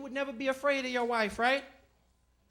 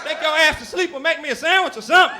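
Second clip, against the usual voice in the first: louder, fed up; exasperated loud outburst; 6 semitones higher, 11 dB louder than usual. Retold or imitated. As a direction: bark it out. Exasperated reply.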